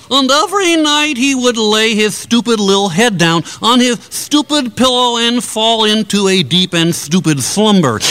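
normal talking voice

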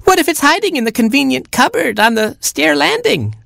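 put-on very high screech of a baby chick. Baby chick fearing the cupboard, screeching high. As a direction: screech in tiny-chick panic. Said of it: "screeched Baby Chick".

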